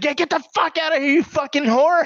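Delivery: high-pitched mock yell, fast at first; mean angry dad. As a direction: imitate a dad viciously throwing someone out. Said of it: "he was being very mean".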